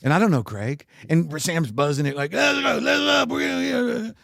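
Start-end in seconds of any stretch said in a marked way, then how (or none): 2.3-4.2 impatient shout. Retold or imitated. Sam buzzing the apartment at night.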